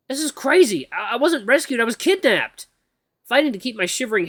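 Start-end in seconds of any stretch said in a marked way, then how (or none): 0.1-2.7 high, loud frightened realization; dawning terror. Acted. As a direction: gasp it out in fear. Realizing kidnapping.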